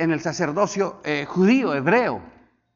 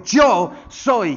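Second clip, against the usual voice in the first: a louder declaring "Yo soy"; Jesus declaring; 4 semitones higher, 7 dB louder than usual. Acted. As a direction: state it with authority. (Jesús declara "Yo soy" con fuerza)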